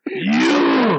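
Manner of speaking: loud, drawn-out taunt; imitated drunk heckling. Repeats the drunk men's heckle.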